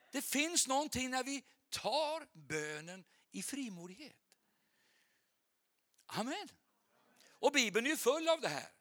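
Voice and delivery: high, emphatic sermon delivery; fervent preaching. Real, not acted. Preacher shows the Bible is full of bold people, fervent preaching. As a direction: drive each example home to the crowd.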